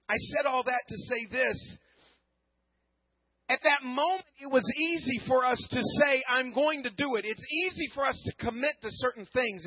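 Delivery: rising sermon climax, slow and emphatic; preaching at full force. Acted. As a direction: drive the point home to the congregation.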